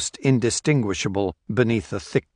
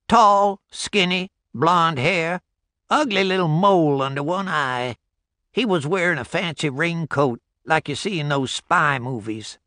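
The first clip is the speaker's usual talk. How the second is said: put-on old country porch-sitter; 7 semitones higher than usual. Old man describing the stranger.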